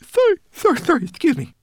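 very high, loud, squeaky servant apology; flustered apology. A narrator's reading. Servant caught yawning before his master, squeaking apologies.

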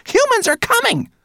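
piercingly high, loud alarm; shrill shriek. A narrator's reading. Rat screaming the warning at brownie, shrill. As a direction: shriek it out at the top of your range. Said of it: "she squeaked so shrilly".

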